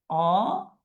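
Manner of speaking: drawn-out syllables with long gaps. Shown as a demonstration. Teacher showing that the first vowel stays open, syllable by syllable. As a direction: spell it out by sound, very slowly.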